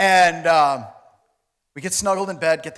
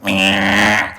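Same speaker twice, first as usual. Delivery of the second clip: loud, low, drawn-out buzzer noise; imitated gate buzzer; 9 semitones lower, 8 dB louder than usual. Comedian imitating the hotel gate's buzzer, loud low buzz. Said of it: "you had to push it, it's like".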